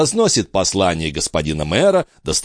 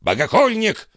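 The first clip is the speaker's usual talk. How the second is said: high-pitched, indignant exclamation; mock-shout of outrage; outraged cry; 12 semitones higher than usual. A narrator's reading. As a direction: hurl the accusation in a raised pitch. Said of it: "вскричал аббат".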